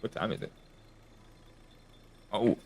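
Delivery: ordinary talk, nothing marked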